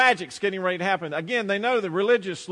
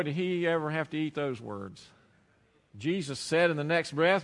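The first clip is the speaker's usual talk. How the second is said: ordinary talk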